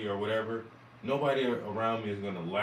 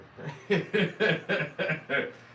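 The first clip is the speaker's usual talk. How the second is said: a real burst of laughter; loud amused laugh; 6 semitones higher, 4 dB louder than usual. Real, not acted. Laughing after quoting uncle.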